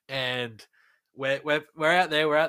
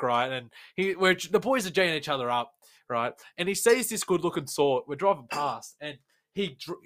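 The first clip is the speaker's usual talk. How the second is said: normal talking voice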